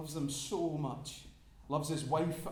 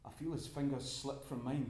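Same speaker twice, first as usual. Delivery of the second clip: usual speaking voice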